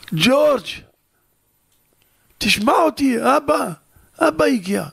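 high, tearful plea with a sob on אבא; grieving father begging his son to hear him; father crying out. Acted. Father begs unresponsive son.

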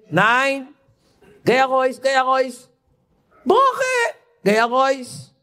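no blessing, get out, and said high; stern dismissal in Yiddish. Retold or imitated. Rebbe turning away the coachman, sternly refusing in Yiddish.

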